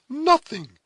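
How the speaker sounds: high, loud, firm single word; firm reply. A narrator's reading. Cora answering astonished Heyward, firm reply. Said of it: "was the firm reply".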